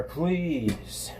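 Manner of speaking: ordinary talk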